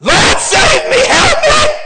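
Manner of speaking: high, loud, panicked shout to the Lord; desperate cry for help. Acted. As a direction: cry out as if drowning in the storm. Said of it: "came to the Lord like that".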